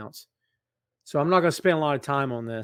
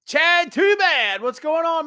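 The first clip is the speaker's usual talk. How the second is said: slow, loud, much higher rhyming shout-out; drawn-out hype greeting; ring announcer call; 12 semitones higher, 8 dB louder, slower than usual. Pretend. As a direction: stretch the name like a ring intro. Greeting viewer with rhyming catchphrase.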